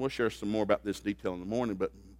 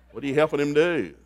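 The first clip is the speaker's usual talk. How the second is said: usual speaking voice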